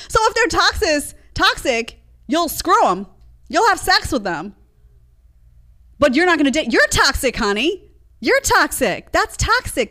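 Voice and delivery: high, indignant retort; indignant scolding. Real, not acted. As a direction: throw it back at them, high and sharp. Throws their word back at them.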